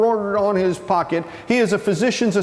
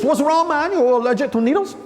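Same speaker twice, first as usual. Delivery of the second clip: raised-pitch questioning; mock needling; 7 semitones higher than usual. Physician's assistant questions a fainting inmate, high-pitched. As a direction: pester him in a higher voice.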